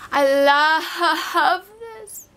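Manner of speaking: I love this stretched slow, with a swooning cry in the voice; drawn-out gush. Real, not acted. Gushing to viewers over the palette she finally owns, slow swoon. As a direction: melt over the words, dragging each one out.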